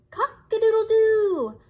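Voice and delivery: loud, high crowing cock-a-doodle-doo; rooster crow. Acted.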